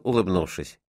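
ordinary delivery

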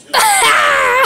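high burst of acted laughter; laughing. Doctor hearing of Bert's burping problem, high laugh. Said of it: "I laughed also".

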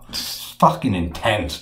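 ordinary talk

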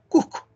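"Cusco" with the s turned into a breath, "Cuhco"; aspirated s. Shown as a demonstration. Teacher demonstrating the limeño "Cusco" with an aspirated s. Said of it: "Aspira la S".

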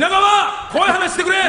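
pitched high, nearly shouted. Acted. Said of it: "もういいよ、怖い話。". (高く大声で呼びかける)

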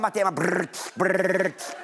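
mimics heavy wheezy breaths, brrrt; heavy breathing imitation. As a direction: buzz out the breathing noise like a mask. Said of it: "und sie machte es vor".

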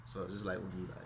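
hushed. Real, not acted. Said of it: "whispered walk-through before kiss".

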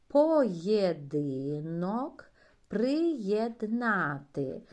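Єдина, єхидна, Європа drawn out slowly; slow model reading for children; slow syllable reading. Shown as a demonstration. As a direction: read each word syllable by syllable. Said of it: "Спробуємо прочитати слова".

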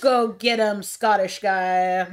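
mock bossy villain. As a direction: bark the order like a cool boss, drawn out. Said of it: "she's like".

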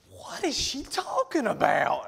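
high, incredulous outburst; mock bafflement. Jilted boyfriend turning around, high baffled outburst. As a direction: squawk it in hammed-up confusion. Retells baffled reaction to mother.

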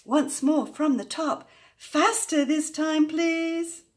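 high, loud, insistent announcement; excited rallying call. A narrator's reading. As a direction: urge everyone into another round, stretch the end. Calls for yet another faster round.

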